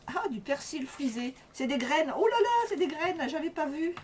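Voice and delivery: delighted high squeal of discovery; excited surprise. Real, not acted. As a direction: gasp with joy at a surprise find. Discovers seeds in the packet.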